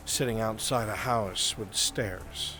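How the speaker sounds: usual speaking voice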